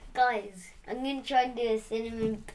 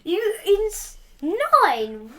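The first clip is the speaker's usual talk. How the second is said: excited protest; 6 semitones higher, 9 dB louder than usual. Real, not acted. Boy loudly refusing many beans.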